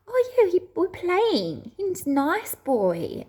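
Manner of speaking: put-on high-pitched toddler voice; small kid's piping, drawn-out answer; two-year-old daughter. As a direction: imitate a little child happily explaining. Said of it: "He goes".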